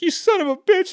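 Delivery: high squealing mock outrage; mock outraged secretary. Secretary snapping at her boss, squeaky mock outrage. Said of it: "And she's like".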